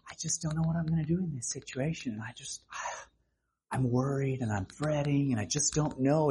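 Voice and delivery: hushed, fast anxious murmur, dropping to a whisper; worried fretting. Acted. Voicing a worried believer, hushed and anxious. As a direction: fret under your breath.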